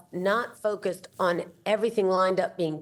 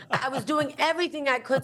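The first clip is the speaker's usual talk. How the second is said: laughing; 7 semitones higher than usual. Real, not acted. Laughing at her own dodging.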